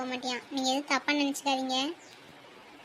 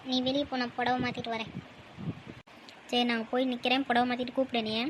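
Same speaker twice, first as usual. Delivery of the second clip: normal talking voice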